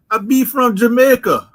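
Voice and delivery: put-on Jamaican accent. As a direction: claim it in a fake Jamaican accent. Pretending to be Jamaican.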